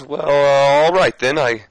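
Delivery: mock Flair backing down; Ric Flair voice. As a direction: give in reluctantly. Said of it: "he says".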